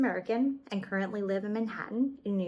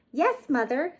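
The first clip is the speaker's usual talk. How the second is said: put-on high, louder child-bunny voice; eager little bunnies saying yes; little bunnies answering; 7 semitones higher, 6 dB louder than usual. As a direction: answer brightly like small bunnies. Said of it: "said the three little bunnies".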